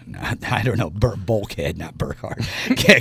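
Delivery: ordinary delivery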